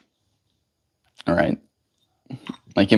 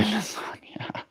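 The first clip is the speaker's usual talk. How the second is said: whisper; 11 semitones higher, 14 dB quieter, slower than usual. Real, not acted. Aside mocking a troll.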